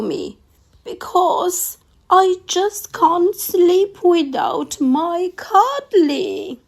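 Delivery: the thing begging through tears; weepy creature voice. Acted. The thing begging Emily Brown to find its cuddly, weepy and pleading. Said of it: "wept the thing".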